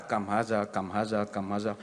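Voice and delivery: low soft rote repetition; muttered memorizing. Retold or imitated. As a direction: drill the phrase under your breath. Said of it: "Dia pun hafal lah".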